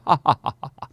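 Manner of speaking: put-on dismissive "ha ha ha"; hammed-up derisive laughter; mock scoffing laugh. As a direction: laugh it off like a scornful skeptic. Mocking the establishment's scoffing laugh.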